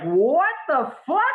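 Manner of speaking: drawn-out, high-pitched disbelief; mock astonishment. Husband reacting to wife's acid trip fact, astonished. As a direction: stretch it out as if utterly stunned. Hears babies are tripping, reacts.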